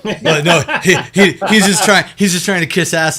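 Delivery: loud, high, laughing protest; excited laughing. Real, not acted. DM laughing loudly at joke.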